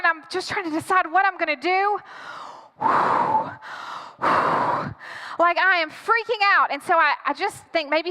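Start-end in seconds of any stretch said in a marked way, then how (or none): 2.8-5.3 acted hyperventilating. Girlfriend at the door, hyperventilating in panic. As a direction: huff hard breaths, mock hyperventilation. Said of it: "I'm fully hyperventilating".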